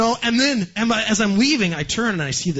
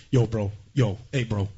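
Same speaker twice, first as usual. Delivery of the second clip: lazy New Jersey tough guy, lower; New Jersey bro; 7 semitones lower than usual. Retold or imitated. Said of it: "talks like this".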